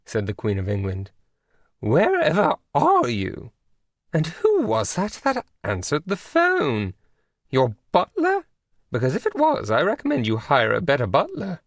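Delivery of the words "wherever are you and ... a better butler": lofty high royal tone; queenly voice. A narrator's reading. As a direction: scold gently with royal poise. Queen of England on phone.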